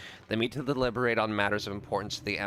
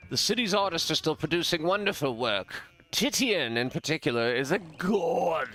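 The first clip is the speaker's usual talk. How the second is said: higher, gushing art-loving nobleman; enthusing lord; 7 semitones higher, 3 dB louder than usual. Acted. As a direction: rave like an aristocrat, drawing out the final word. Voices the lord praising artists.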